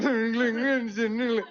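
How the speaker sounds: loud mock baby babble; baby noises. Kids on the stairs making loud baby noises. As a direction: coo it like a toddler showing off. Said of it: "we were going".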